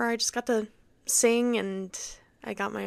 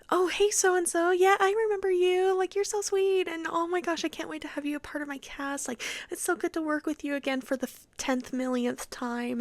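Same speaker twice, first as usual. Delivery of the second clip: gushy favoritism; 8 semitones higher than usual. Retold or imitated. Director greeting a favorite cast member, mock gushing. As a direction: put on a sugary, high director voice.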